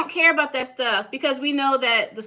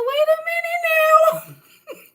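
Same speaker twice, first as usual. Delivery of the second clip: much higher, slow dawning wait a minute; retold realization; slower than usual. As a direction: stop yourself as it clicks, pitched way up. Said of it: "But then I said".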